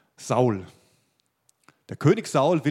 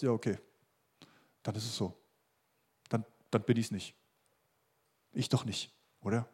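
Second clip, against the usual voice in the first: lower, softer, mildly deflated aside; resigned self-talk; 4 semitones lower, 6 dB quieter than usual. Acted. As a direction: voice a discouraged thought, dropping low and quiet. Acts out discouraged inner self-talk.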